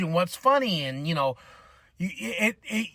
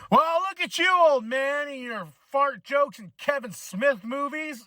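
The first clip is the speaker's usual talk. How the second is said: higher, drawn-out mock jeer; mocking young critic; 6 semitones higher, slower than usual. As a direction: tease the old man for his tastes. Kids mocking him as old man.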